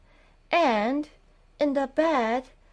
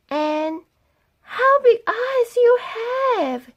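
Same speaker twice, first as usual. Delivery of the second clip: put-on little girl voice; 13 semitones higher than usual. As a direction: pipe up like a small girl. Girl asking grandma.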